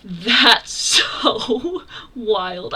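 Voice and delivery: real loud, drawn-out astonishment; genuinely flabbergasted. Real, not acted. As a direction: stretch the words out, floored and loud. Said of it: "how genuinely flabbergasted I am".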